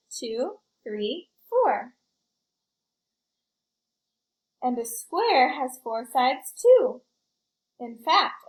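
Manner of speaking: put-on lively read-aloud for kids; story narration. A narrator's reading. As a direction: tell it like a picture book to a class. Reads picture book to children.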